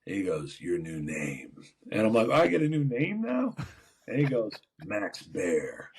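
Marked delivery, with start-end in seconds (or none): 0.6-1.5 lightly voiced older man, low and soft; older coach. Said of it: "it was an older man". 2.3-3.5 high, rising mock disbelief; surprised question. Player reacting to new name. 4.8-5.9 put-on low older man's voice; older coach. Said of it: "it was an older man".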